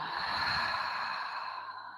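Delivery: long slow audible exhale; slow deep breaths. Shown as a demonstration. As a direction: release the breath with a sigh. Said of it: "And exhale out".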